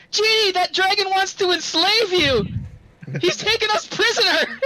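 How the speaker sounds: high, loud urgent cry; excited shout. Acted. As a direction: yell it to the genie in alarm. Character shouting to the genie.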